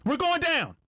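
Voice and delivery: high, strained distress call over the radio; sudden mayday cry, pitched high; panicked pilot. Retold or imitated. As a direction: blurt it out like a pilot in trouble. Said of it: "Next thing you hear is".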